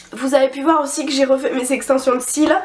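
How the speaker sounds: usual speaking voice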